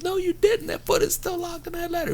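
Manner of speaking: very high mock-scolding voice; imitated angry retort. Boss contradicting Dean about the ladder, mock angry. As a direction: argue back in a high, put-on voice. Boss argues back, retold quarrel.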